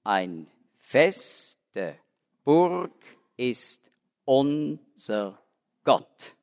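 Ein feste Burg ist unser Gott slowed, every syllable weighed; slow, measured hymn meter, syllable by syllable; metered verse recital. Shown as a demonstration. Lecturer showing German verse counts each syllable, slow metered recital. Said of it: "in der Poesie zählt man natürlich jede Silbe".

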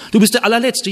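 hammed-up scornful accuser; mock insult. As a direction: fling the insult lightly. Someone insulting the humble man.

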